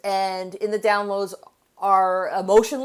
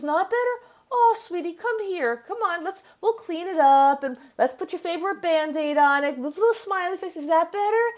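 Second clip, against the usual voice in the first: put-on higher, coaxing mother's voice comforting a crying child; soothing mommy; 8 semitones higher than usual. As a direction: coax the child gently, like a doting mom. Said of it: "And the mommy says".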